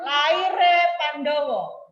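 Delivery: loud, slow and clear title; wyraźne czytanie. Shown as a demonstration. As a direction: announce the title slowly and clearly. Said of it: "harus jelas ya".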